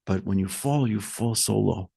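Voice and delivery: very low and soft; sunken, grave hush. Retold or imitated. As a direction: drop right down as if fallen. Word-painting the fall from heights.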